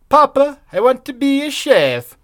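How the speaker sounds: a hammed-up kid voice telling his father his dream; mock little boy. Voicing child Swedish Chef.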